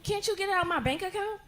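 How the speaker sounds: mock little-girl question; imitated daughter. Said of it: "She said".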